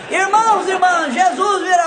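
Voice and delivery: high preaching call to the congregation; preacher's shout. Retold or imitated. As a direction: announce it to the whole church. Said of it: "o pastor começou a gritar". (pastor anunciando à congregação, agudo)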